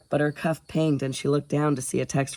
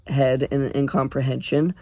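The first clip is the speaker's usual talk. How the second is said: plain reading voice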